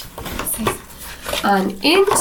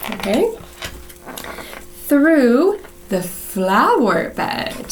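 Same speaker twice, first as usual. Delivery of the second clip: usual speaking voice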